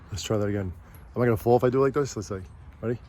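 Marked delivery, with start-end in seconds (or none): none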